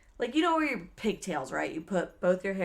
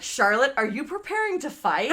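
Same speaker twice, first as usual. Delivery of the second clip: higher, louder imitation of a friend; startled friend; 5 semitones higher, 5 dB louder than usual. Friend sees her taking earrings out, startled. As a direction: ask in mock disbelief.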